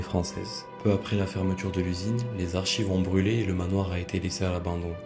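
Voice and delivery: calm voiceover storytelling; documentary narration. Acted. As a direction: read it like a doc voiceover. Narrated history over music.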